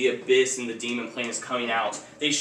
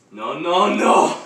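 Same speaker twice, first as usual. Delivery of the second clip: rising, building cry of no; alarmed refusal; 5 semitones higher, 9 dB louder, much slower than usual. Acted. Villain protests as the heroes escape, building shout. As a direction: climb from raised voice to loud yell. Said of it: "he's like".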